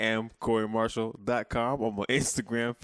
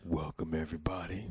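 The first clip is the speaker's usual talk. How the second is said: low, soft creepy host voice; a hushed, eerie welcome; 6 semitones lower, 7 dB quieter than usual. Pretend. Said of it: "You back with the creepy voice".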